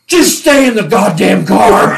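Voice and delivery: loud barked order; loud, angry barked command; angry shout. Retold or imitated. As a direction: sergeant ordering the rookie to stay put. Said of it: "He goes".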